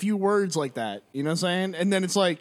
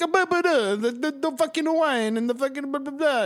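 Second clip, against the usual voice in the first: mock Italian; 8 semitones higher than usual. Showing how all Italian sounds, mock Italian singsong. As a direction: babble like an exaggerated Italian, pitched way up. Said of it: "But in Italian, everything is, is like".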